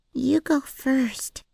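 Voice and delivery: put-on high, slow child's voice; small girl telling her to go; little girl voice. A narrator's reading. Girl voice in story.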